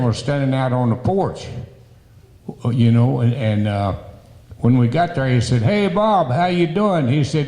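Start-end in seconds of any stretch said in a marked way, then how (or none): 5.6-7.1 higher-pitched retold hello; imitating a hearty host's hello; hearty greeting. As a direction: call a greeting to an old acquaintance, pitched up. President greets an old acquaintance.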